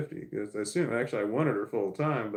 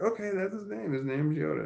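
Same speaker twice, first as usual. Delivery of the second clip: put-on lightly higher, kid-like daughter; a hint of a little girl agreeing; daughter's voice; 9 semitones higher than usual. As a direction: play the young daughter, light and bright. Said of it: "And she's like".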